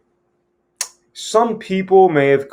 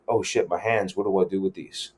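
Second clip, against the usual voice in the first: anxious mutter; 3 semitones lower, 7 dB quieter than usual. Retold or imitated. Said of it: "we'll always feel like".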